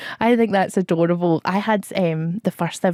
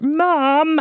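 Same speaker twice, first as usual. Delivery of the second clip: calling Mom; 8 semitones higher than usual. Pretend. Imagined advert kid calls for mum, mock call. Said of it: "is gonna be like".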